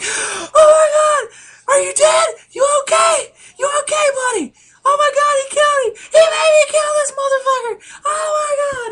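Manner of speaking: hysterical falsetto wailing at full volume; cry-shout it very high, as a comic imitation; mock bawling. Gangster who just shot his buddy by accident, mock bawling. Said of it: "hearing you do that voice".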